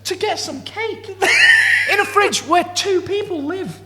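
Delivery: high, loud and laughing; incredulous laughing rant. Real, not acted. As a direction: shout it out in disbelief.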